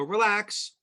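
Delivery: high, drawn-up "Relax" to an upset client; acted broker's reply. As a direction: play at soothing an irate customer. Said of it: "And you go".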